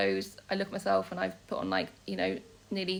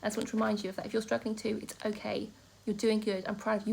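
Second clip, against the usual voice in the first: ordinary talk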